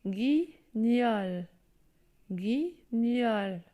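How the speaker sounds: guignol split slowly, gui-gnol; drawn-out teacher reading of guignol; syllable reading. Shown as a demonstration. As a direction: read out guignol syllable by syllable for the class. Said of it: "Je lis".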